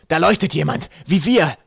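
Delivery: high, loud astonished shouting; bellowed. A narrator's reading. The flathead calls to Schliet about Twig, bellowed. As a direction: roar the discovery out. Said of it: "brüllte er".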